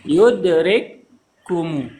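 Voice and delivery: "Yudrikkumu" slow, doubled kaf held; merged kaf. Shown as a demonstration. Teacher showing the kaf merged into one, slow. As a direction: hold the kaf in "Yudrikkumu" like a shadda. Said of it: "Kafnya digabungkan jadi satu".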